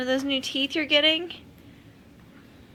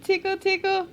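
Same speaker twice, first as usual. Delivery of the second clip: playful sing-song tickle, higher and brighter; giggly tickle attack; 7 semitones higher than usual. Acted. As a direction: tease the baby with a playful tickle. Mother tickling baby's feet.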